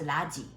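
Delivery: "large" said as "la-ji", no r; Korean-style mispronunciation. Shown as a demonstration. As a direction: add a vowel to the end of "large". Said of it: "Nobody understand you". ("large"를 "라지"라고 한국식으로)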